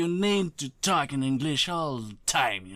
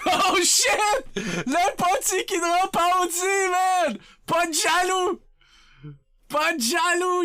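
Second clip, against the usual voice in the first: laughing hype; 8 semitones higher, slower than usual. Real, not acted. Streamer laughing and cheering at a surprise sub drop.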